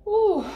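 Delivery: high, loud "ooh" to release emotion; emotional exhale. Real, not acted. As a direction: let out a sudden high puff of feeling. Steadying herself after getting emotional.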